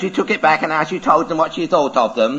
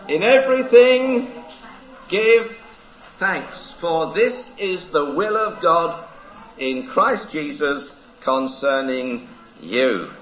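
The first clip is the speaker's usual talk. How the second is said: scripture reading; 3 semitones higher, slower than usual. Acted. Reading the Bible verse.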